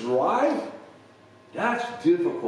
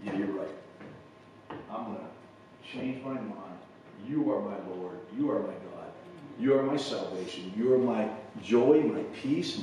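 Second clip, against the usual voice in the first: surrendering prayer; 5 semitones lower, 4 dB quieter than usual. Retold or imitated. Preacher's surrender prayer, retold.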